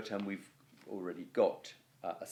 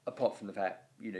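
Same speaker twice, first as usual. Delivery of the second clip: normal talking voice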